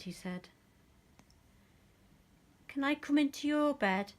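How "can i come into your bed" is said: high, loud small-mouse plea; squeaky little mouse asking; Little Mouse's voice. A narrator's reading. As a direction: pipe it up like a tiny pleading mouse. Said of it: "asked Little Mouse".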